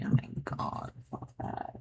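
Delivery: whispered curse, no voice. Real, not acted. Poet dreading ten market hours, whispering. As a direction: breathe it out in disgust.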